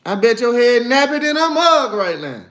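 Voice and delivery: mock roast. Real, not acted. Teasing a viewer in chat.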